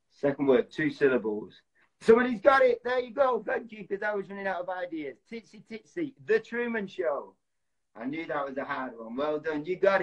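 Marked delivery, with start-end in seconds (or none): 2.0-7.4 high-pitched burst of real excitement, easing in volume; excited. Real, not acted. Host to guessers as the film is finally guessed, excited. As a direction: celebrate the right guess in a raised, delighted pitch. Correct guess arrives in charades game.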